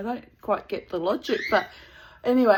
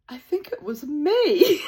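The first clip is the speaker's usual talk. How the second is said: put-on slow, higher American-accented deadpan; 6 semitones higher, slower than usual. Retelling the actor's punchline to the waiting celebrities, dry American accent. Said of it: "in his American accent".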